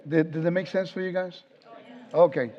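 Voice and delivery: ordinary delivery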